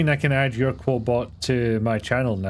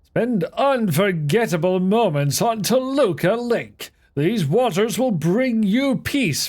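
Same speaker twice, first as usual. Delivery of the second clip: higher, slow, sing-song advert voice; mock tourism ad; 8 semitones higher, slower than usual. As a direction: sell the lake like a soothing holiday ad. Mock advert for Toluca Lake.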